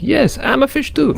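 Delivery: high, goofy fish character talking; silly fish voice. Retold or imitated. Narrating an animal AI, the fish speaks in a high silly voice. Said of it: "And it's like".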